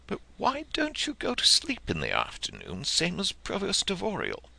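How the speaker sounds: put-on high child's voice, innocently puzzled; a little boy naively questioning a grown-up; high curious child voice; small boy asking. A narrator's reading. Boy speaks in story.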